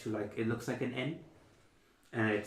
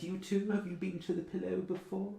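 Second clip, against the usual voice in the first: high elf receptionist; 9 semitones higher than usual. Acted. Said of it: "she says".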